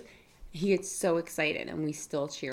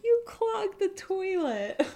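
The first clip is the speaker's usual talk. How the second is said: high, slow mock outrage; shocked disbelief; much slower than usual. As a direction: stretch it out in disbelief. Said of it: "I was like".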